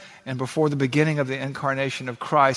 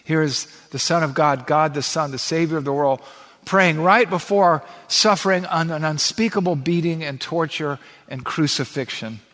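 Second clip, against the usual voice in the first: ordinary talk, nothing marked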